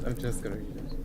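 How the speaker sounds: soft, low, hushed reading voice; a hushed wise old voice; Looks to the Moon voice. Acted. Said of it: "Is it time for Looks to the Moon voice?".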